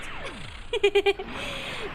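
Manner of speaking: high bright laugh bursting out; loud amused laugh; burst of laughter. Real, not acted. Laughing at accent question.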